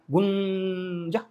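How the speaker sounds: long, steady droning hum; imitated drone hum. Telling viewers drones were sent, long imitated drone hum. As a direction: draw it out like a buzzing drone.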